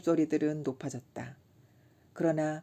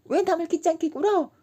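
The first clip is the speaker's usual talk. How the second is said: much higher dainty lady voice; mimicking a lady; 13 semitones higher, 7 dB louder than usual. Kim mimicking the haughty schoolgirl for laughs, high and dainty. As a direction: chirp it like a prim young lady. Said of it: "정말 꾀꼬리 같은 소리를 내었다".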